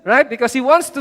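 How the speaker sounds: normal talking voice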